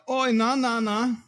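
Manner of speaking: high, hammed-up Oi nah nah; mock-protective broad Antipodean mates voice; a sibling sticking up for family, pitched high; broad Aussie-Kiwi voice. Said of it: "be like".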